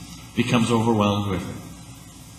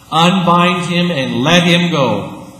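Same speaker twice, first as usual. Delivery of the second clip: louder, slow, weighty repeat; slow emphatic proclamation; 5 semitones higher, 7 dB louder, slower than usual. Retold or imitated. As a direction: preach it to the congregation. Repeated as sermon climb.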